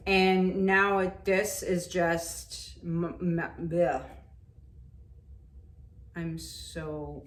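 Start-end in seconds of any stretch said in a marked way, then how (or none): none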